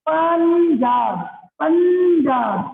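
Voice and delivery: पंजाब drawn out slowly, nasal after प; slow pronunciation demo. Shown as a demonstration. Teacher repeats पंजाब for students, slow demo. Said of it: "बोल के देखो".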